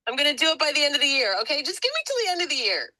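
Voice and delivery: fast, high, laughing bargaining; laughing plea. Real, not acted.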